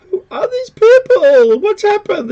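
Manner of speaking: high-pitched wail of disbelief; loud, drawn-out start, staying high and baffled; mock bewilderment. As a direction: cry out in hammed-up confusion. Baffled viewers wail about the cast.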